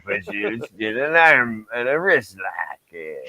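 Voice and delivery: a lightly voiced old Stu Hart rasp; a hint of gruff old Stu Hart, slow; Stu Hart impression. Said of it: "You talk like Stu Hart?".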